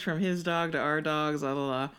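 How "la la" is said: ordinary talk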